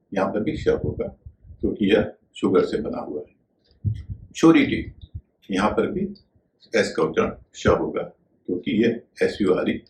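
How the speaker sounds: ordinary delivery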